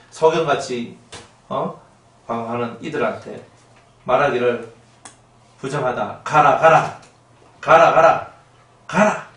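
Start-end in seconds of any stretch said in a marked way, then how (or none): 6.2-9.3 크게 내쫓듯 가라를 외쳐; 더러운 자를 쫓아내는 호통. Acted. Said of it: "이렇게 하는 거예요". (소리 높여 쫓아내는 호통)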